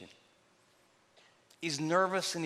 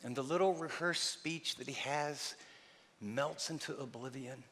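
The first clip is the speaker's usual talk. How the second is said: ordinary talk, nothing marked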